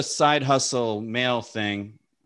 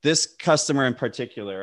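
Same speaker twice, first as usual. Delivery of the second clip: ordinary talk, nothing marked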